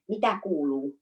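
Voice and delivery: low-pitched Finnish phrase; Finnish accent. Shown as a demonstration. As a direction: say it like a native Finn. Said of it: "very Finnish in a Finnish accent".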